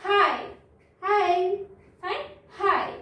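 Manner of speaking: ordinary delivery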